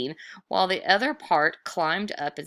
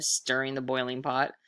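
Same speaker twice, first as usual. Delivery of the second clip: ordinary delivery, nothing marked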